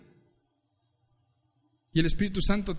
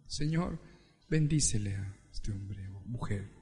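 soft prayer; 12 semitones lower, 8 dB quieter than usual. Retold or imitated. Driver praying for the reckless driver, soft. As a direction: lower and softer blessing. Quiet prayer after near crash.

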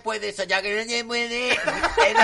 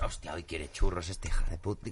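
ordinary talk

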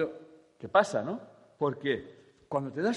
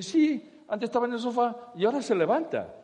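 squeaky high, loud mock wife, laughing; 10 semitones higher, 8 dB louder than usual. The lady insists her husband now gets up, high and laughing. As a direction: play the wife high and chirpy. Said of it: "Pues dice".

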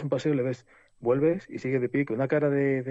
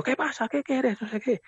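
retold yelling; 11 semitones higher, slower than usual. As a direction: challenge him in a raised, high voice. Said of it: "le gritaba, yo a gritos". (imitando gritos de enfrentamiento, muy agudo)

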